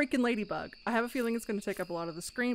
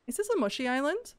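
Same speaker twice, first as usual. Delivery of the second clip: ordinary talk, nothing marked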